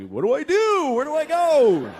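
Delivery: high, loud helpless panic; whiny alarm at being stuck in hell; panicked lost soul. Acted. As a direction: cry out, lost and scared.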